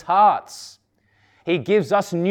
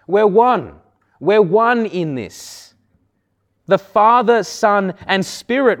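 higher and louder, rising pulpit fervour; fervent preaching; 6 semitones higher, 4 dB louder than usual. Acted. Pastor exhorting his congregation about God's hand, fervent preaching. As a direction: preach it out with mounting conviction.

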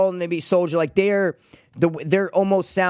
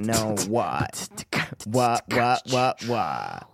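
low goofy voice; 8 semitones lower, 5 dB quieter, much slower than usual. Pretend. Low goofy sung outro.

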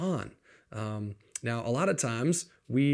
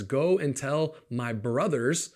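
slow, with weight on "Go"; deliberate commission of Jesus; slow emphatic quote; slower than usual. Retold or imitated. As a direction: spell out the command slowly. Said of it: "Note the masculine there".

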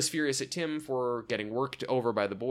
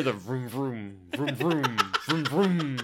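mock engine vroom; slower than usual. Imitating race car engine noise.

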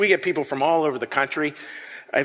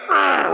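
frustrated growl; 7 semitones higher, 4 dB louder than usual. Shown as a demonstration. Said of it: "We all know that".

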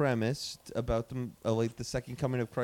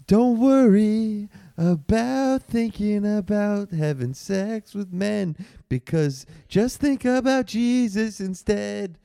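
over the microphone; 8 semitones higher, 6 dB louder, slower than usual. Pretend. Blasting through the microphone.

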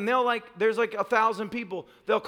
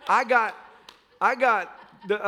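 normal talking voice

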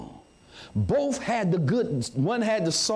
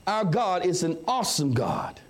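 ordinary delivery

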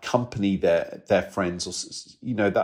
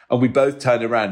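ordinary delivery

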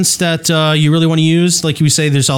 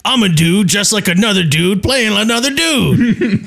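higher-pitched mock movie-character voice; movie quote; 5 semitones higher than usual. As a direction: quote the film line in a put-on voice, laughing. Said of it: "You know what that reminded me of".

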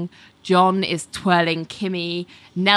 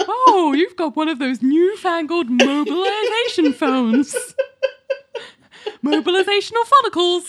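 mock old-fashioned voice; 12 semitones higher than usual. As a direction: play a bewildered old-timer amazed by gadgets. Mock old-timer marvelling at phones.

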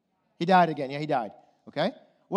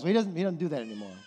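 fast, stammered mock brush-off; dismissive answer; 3 semitones lower, much faster than usual. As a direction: hurry past the question dismissively. Acted dismissive church answer.

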